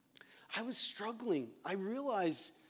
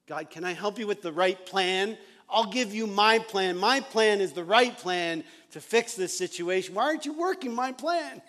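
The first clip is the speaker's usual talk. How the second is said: demanding complaint; 4 semitones higher, 9 dB louder than usual. Retold or imitated. Retold self demanding God's way.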